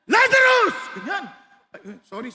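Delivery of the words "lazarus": calling Lazarus out loud and high; shout. Retold or imitated. Preacher acting Jesus calling Lazarus from the tomb, loud commanding shout. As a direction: call the dead man out of the tomb. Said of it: "Sumigaw eh".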